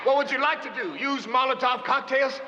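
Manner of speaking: high-pitched, forceful calling to the crowd; impassioned rebuttal. Acted. Leader telling crowd what the movement is not, impassioned calling. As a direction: push your voice up over the uproar. Argues over an agitated crowd.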